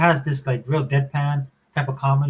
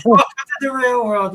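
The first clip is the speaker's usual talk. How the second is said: higher, louder and slow, a grand mock welcome; hamming up a dad's life lesson; teasing punchline; 10 semitones higher, 6 dB louder, slower than usual.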